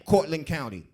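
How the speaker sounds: ordinary talk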